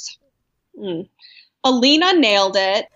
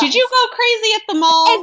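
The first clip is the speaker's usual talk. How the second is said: mock Tyra; 11 semitones higher, 4 dB louder than usual. Imitating Tyra's question.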